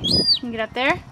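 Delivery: normal talking voice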